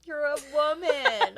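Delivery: laughing while teasing. Real, not acted. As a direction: laugh through the words.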